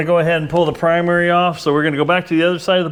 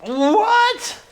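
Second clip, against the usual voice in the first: high-pitched, drawn-out mock "what?"; shocked viewer. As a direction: squeal it like an incredulous onlooker. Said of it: "some of you might be going".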